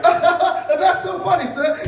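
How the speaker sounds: loud, high, slow forced laughing; hammed-up fawning laughter. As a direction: fake big laughs to please someone. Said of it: "he and I both together are like".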